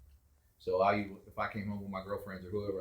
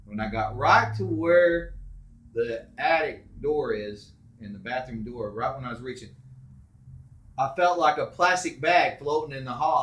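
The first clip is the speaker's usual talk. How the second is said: ordinary delivery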